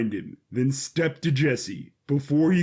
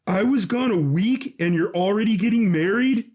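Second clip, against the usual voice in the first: indignant outrage; 6 semitones higher, 3 dB louder than usual. Acted. Jesse confronts brother at wedding.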